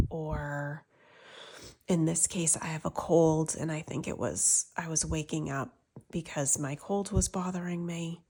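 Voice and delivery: ordinary talk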